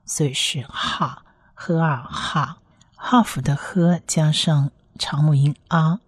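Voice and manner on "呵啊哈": 呵 and 啊 blended slowly into 哈; sounds blended. Shown as a demonstration. Teacher builds the final syllable, blending 呵啊 into 哈. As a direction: spell out 呵, long 啊, then 哈. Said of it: "它是长母音啊".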